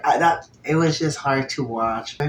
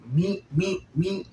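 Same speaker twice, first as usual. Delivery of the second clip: sound effects; 6 semitones higher, slower than usual. Retold or imitated. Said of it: "the sound effects, the onomatopoeia".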